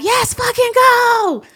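high, loud celebratory yell with drawn-out words; reenacted scream of triumph; excited scream. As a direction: scream it like you just got the best news. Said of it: "I was screaming like".

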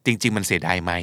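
usual speaking voice

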